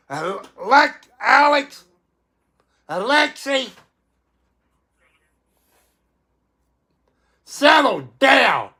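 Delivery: high, stern calling of names; scolding call. Real, not acted. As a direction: call them out one by one to stop. Scolding cats by name.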